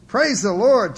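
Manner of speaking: loud, high interjection; chiming in. A narrator's reading. Husband breaking into the quarrel, loud chime. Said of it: "chimed Ray".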